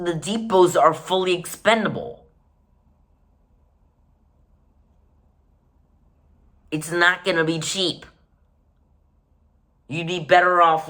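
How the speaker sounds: building exasperated rant; escalating comedy rant. Real, not acted. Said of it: "Rant over".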